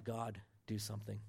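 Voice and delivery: a soft, low-voiced plea; said quietly. Shown as a demonstration. Pastor showing the prayer he usually yells, quietly. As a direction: keep it down, hushed and small. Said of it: "That's me saying it quietly".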